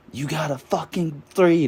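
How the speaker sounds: hammed-up father scolding, high and sharp; scolding dad. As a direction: imitate dad laying into his kid. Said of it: "my dad was just laying into me".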